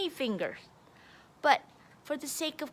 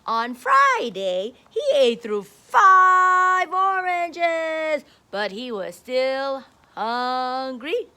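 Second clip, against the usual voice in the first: put-on reading to children; 2 semitones higher, 12 dB louder, slower than usual. A narrator's reading. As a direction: draw out the five oranges for the class. Reading picture book to kids.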